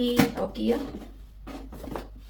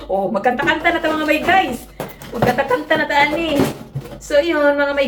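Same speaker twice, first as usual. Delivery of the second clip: high, louder giddy chatter; excited laughing; 6 semitones higher, 5 dB louder than usual. Real, not acted. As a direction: laugh and gush about singing. Laughing, excited about singing. (masaya at tumatawa)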